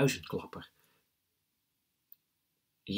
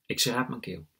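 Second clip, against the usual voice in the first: plain reading voice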